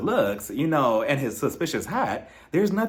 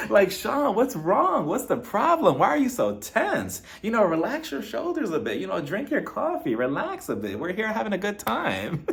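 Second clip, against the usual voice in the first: mock coaxing; 6 semitones higher than usual. Coaxing Sean like a therapist.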